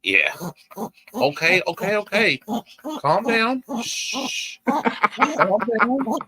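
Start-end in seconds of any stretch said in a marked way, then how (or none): 1.1-6.2 high, cooing pet-talk with hushing; soothing an excited dog. Real, not acted. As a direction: coax a little dog to settle down. Soothing an over-excited pet chihuahua.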